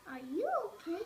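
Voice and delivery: ordinary delivery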